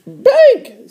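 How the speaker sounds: very high little-sister voice. Acted. Little sister reading a sign to her brother, very high voice. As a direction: pitch it way up like a cartoon kid sister. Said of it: "said D W".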